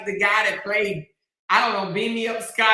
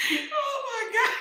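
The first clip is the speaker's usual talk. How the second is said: soft, high, drawn-out laugh-talk; laughing to tears; 14 semitones higher, 8 dB quieter, slower than usual. Real, not acted. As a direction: squeeze it out through helpless laughter. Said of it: "make me cry".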